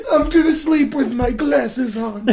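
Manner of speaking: high, slow goofy voice; silly cartoon voice. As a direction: drag it out goofily. Mocking Sonic line.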